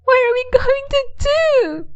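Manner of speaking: high, desperate mother's cry. A narrator's reading. Mother cries out over her changing daughter, high and desperate. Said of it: "cried Missus Scream".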